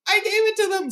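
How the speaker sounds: high frightened squeak. Acted. Frightened Bilbo confesses from the wall, high squeak. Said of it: "squeaked Bilbo".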